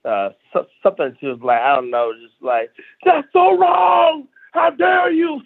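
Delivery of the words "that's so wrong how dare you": mock-outraged drawn-out high yell; imitating outraged yell. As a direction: stretch it out high, like an indignant heckler. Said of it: "lady yelled out something".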